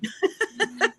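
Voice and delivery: a quick rising giggle; short giggle. Real, not acted. Host laughing at her love of piggy chocolates, quick giggle. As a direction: laugh lightly at yourself.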